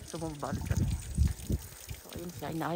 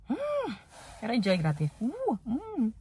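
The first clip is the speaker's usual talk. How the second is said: high hum; 4 semitones higher, 7 dB quieter than usual. Real, not acted. Reacting to cold.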